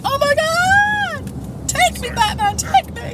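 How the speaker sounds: very high, squealing fangirl; high mock squeal of adoring fans; swooning fans. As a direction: shriek it like a swooning fan. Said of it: "And everyone's like".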